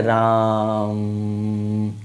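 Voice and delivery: राम with its vowel held long and slow; long drawn-out Ram. Shown as a demonstration. Teacher to pupils, showing a prolonged vowel: राम drawn out slowly. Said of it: "ऐसे बोलते हैं".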